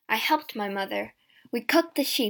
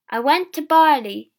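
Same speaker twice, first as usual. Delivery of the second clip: loud, higher, pleased announcement; happy; 4 semitones higher, 12 dB louder than usual. A narrator's reading. James back with a sheep, happily. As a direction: announce it proudly and brightly. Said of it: "James said happily".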